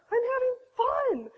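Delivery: high, delighted exclamation; exclaiming out loud. Retold or imitated. Mother recalling her labour to women, exclaiming out loud. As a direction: blurt it out with high-pitched glee. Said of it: "I remember saying out loud".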